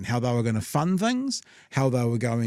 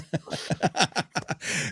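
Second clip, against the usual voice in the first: breathy, amused laugh; can't hold the laugh in; real laugh; 4 semitones higher, 4 dB quieter than usual. Real, not acted. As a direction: burst of helpless giggling. Laughing at the starred T-shirt.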